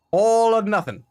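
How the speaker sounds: dramatic declaration. Pretend. Dramatic leap in game.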